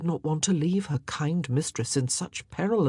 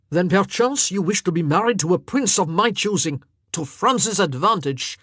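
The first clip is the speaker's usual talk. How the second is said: louder, menacing king; nasty retort; 3 semitones higher, 6 dB louder than usual. A narrator's reading. King threatening the queen, nasty and loud. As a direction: turn cold and threatening. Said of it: "he retorted nastily".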